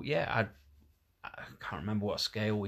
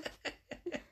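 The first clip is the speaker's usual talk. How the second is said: goblin giggle; 7 dB quieter than usual. Real, not acted. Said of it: "that was a goblin giggle".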